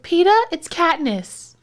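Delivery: high, slow calling voice; imitating Katniss. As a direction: call out high and drawn out like Katniss. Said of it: "Like when she's like".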